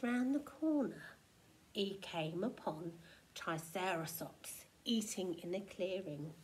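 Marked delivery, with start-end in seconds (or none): none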